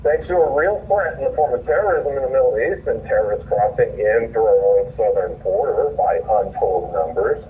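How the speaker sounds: ordinary talk, nothing marked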